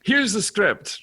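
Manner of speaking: high, loud director voice; John Landis. Retold or imitated. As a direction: hand it over brashly, imitating the director. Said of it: "So he went".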